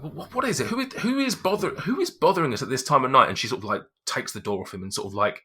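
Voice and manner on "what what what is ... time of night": indignant wife. Acted. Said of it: "She's like".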